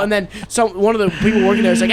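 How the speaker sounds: usual speaking voice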